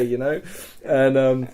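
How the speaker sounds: ordinary delivery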